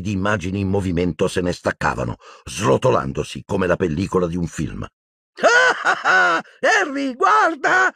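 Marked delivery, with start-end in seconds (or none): 5.3-7.9 very high giddy laugh into a call; laugh, then cry out in delight. A narrator's reading. Ron shows Harry the flying brain, laughing. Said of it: "rise Ron".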